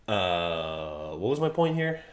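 usual speaking voice

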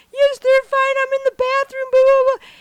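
high, loud, tearful rush of words; tearful reply. Retold or imitated. Mom answering her husband from the bathroom, high tearful rush. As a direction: blurt it out through tears.

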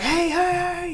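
high urgent calls of hey; calling out. Retold or imitated. Friend calling out to the driver to stop the truck, high and urgent. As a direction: shout hey up high to get his attention.

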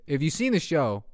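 usual speaking voice

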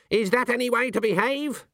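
high-pitched scolding heckle; indignant snap. A narrator's reading. Granny tells off the puppets at the show, snapping. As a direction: snap it like a cross old scold. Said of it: "snapping things like".

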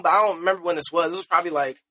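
ordinary talk

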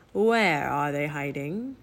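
low, slow, raspy demand; raspy Raven King. A narrator's reading. Raven King hunts the thieves, slow raspy voice. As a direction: draw the question out menacingly. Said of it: "he asked in a raspy voice".

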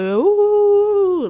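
loud, high imitated ooh; a drawn-out, loud, high teasing ooh; mock shocked ooh. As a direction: mimic family gasping at your weight. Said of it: "y'all starting off with".

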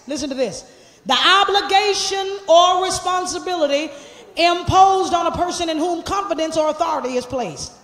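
loud, rousing sermon delivery; full-force preaching. Acted. Pastor rebuking hypocritical churchgoers, preaching loud and fired up. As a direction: preach it hard and call them out.

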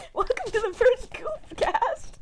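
laughing through the intro line; giggling, barely holding it together. Real, not acted. Laughing while restarting the intro.

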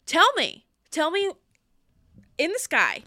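heated rant. Real, not acted. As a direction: vent your frustration. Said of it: "literally heated right now".

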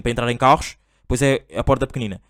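ordinary delivery

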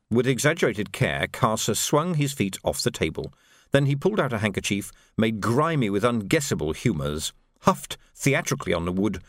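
plain reading voice

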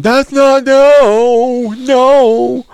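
high, loud mock protest. Real, not acted. Host cuts in with a high loud no. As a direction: squeal no, no, no.

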